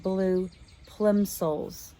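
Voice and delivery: "bright blue plimsolls" said slowly, word by word; slow careful reread. Shown as a demonstration. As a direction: reread the correction slowly and deliberately. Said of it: "No, I read that wrong".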